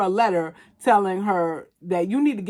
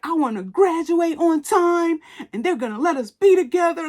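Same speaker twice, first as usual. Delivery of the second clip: high-voiced teenage earnestness, gently mocked; teenagery demands; 8 semitones higher than usual. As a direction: imitate a naive teen declaring demands, pitched up. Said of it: "the most teenagery demands".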